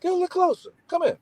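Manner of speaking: high, sing-song coaxing threat; menacing dad, high mock. Father luring his son closer with the spoon. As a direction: coax the kid closer, falsely sweet. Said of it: "He said".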